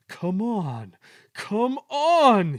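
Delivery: loud effortful urging; puffing. A narrator's reading. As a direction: heave the words out with effort. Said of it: "he puffed".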